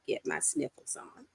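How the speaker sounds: breathy, soft aside; soft whispered aside. Real, not acted. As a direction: whisper it to yourself. Talking about crying.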